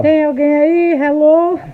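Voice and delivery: hello-is-anyone-there holler; playful calling out. Pretend. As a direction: call into the void to see who's listening. Calling out to check the sound.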